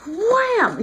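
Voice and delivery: high, punchy "wham"; exclaimed impact word. Retold or imitated. Retelling Hephaestus's hammer swing, exclaimed high. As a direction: throw it out like a hammer blow.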